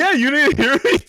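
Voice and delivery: high-pitched laughter through the words; laughing. Real, not acted. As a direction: laugh while asking it. Teasing co-host laughing mid-sentence.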